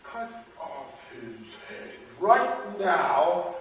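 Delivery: slow, loud, menacing royal rage; angry king. A narrator's reading. King threatening the quibbling shoemaker, angry.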